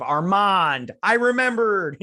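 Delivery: high, loud burst of sudden remembering; excited high exclamation; triumphant recall. Real, not acted. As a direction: blurt it out, proud to have remembered. Suddenly recalls the forgotten name.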